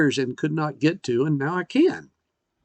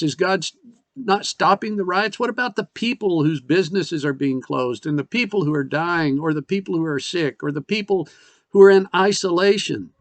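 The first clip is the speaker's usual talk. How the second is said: ordinary talk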